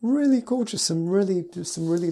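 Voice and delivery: gushing, pitch leaping high; excited. Real, not acted. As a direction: rave about it, voice jumping up. Gushing over a favourite piece.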